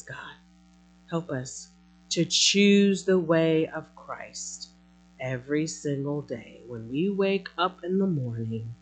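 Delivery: normal talking voice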